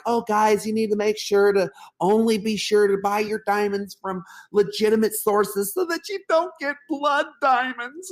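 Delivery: high, airy put-on voice of a know-it-all; mocking preachy classmate. Mimicking a classmate lecturing the class about diamonds, high mocking voice.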